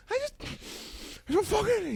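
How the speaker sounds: very high voice-breaking cry; crying outburst. Retold or imitated. Crying at dinner with dad and brother, very high voice cracking. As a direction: break down mid-sentence. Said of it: "I went full beat".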